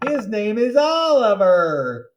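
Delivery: loud, high and slow showing-off; loud excited announcement of a class pet; excited introduction. Acted. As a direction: present the pet with big excitement.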